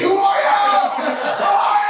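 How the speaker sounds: very high, loud screaming; exasperated shout. Acted. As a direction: shriek it at a stranger in exasperation. Caller's acted outburst at the heckler.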